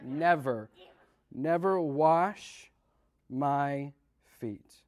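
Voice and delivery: never stretched out very slowly; stress on never. Shown as a demonstration. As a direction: lean hard on each never. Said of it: "somebody say never".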